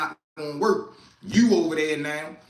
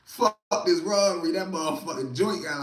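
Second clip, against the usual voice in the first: usual speaking voice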